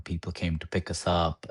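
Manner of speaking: ordinary talk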